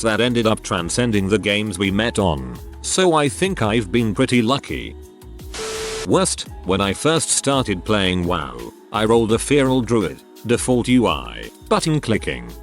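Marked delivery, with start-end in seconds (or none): none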